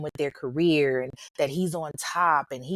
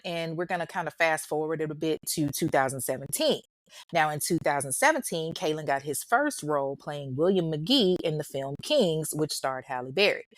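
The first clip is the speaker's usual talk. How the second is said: ordinary talk, nothing marked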